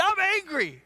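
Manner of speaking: high-pitched indignant outburst; mock tantrum; hammed-up anger. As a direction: whine it out as an angry Jonah, mock furious. Jonah's angry answer, acted.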